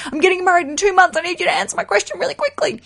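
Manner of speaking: high, rushed panicky bride impression; frantic listener. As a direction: gabble it in a high mock-urgent voice. Voicing anxious, urgent listeners.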